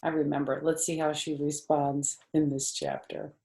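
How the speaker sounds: ordinary delivery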